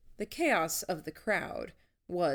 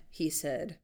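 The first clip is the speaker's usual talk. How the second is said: usual speaking voice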